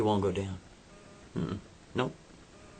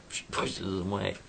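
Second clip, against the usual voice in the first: usual speaking voice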